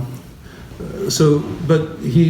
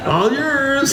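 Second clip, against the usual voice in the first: laughing; 13 semitones higher than usual. Real, not acted. Laughing at the story's punchline.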